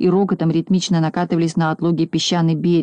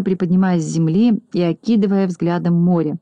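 plain reading voice

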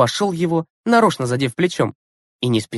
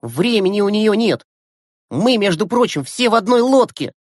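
indignant; 7 semitones higher than usual. A narrator's reading. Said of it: "возмутился Глеб".

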